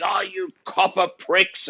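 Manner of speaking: loud, high, drawn-out mock protest; drunken abuse. Drunk bloke being grabbed by police, loud indignant yell. Drunk man abusing police who grab him.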